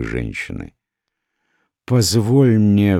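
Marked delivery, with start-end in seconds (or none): 1.8-3.0 slow, raised-pitch plea; an understated entreaty, drawn out; pleading. A narrator's reading. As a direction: beg gently, stretching the words. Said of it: "взмолилась она".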